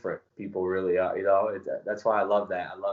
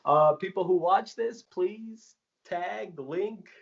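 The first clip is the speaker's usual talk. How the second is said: mock pleading; 11 semitones higher, slower than usual. Plea to viewers to tag.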